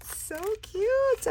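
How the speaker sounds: high cooing over something cute. Real, not acted. Crafter admiring a cute embellishment, high coo.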